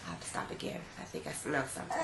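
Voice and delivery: soft unvoiced whisper. Real, not acted. Pauses for baby, hushed aside.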